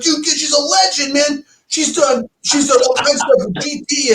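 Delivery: high-pitched, excited retelling; imitating excited brother. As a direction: gush like an impressed brother. Brother's awed reply, retold.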